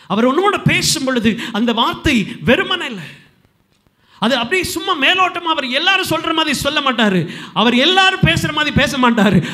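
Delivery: high, loud preacher building to a firm close; forceful sermon delivery; emphatic preaching. Real, not acted. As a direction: drive the assurance home to the congregation. Preaches certainty of God's word.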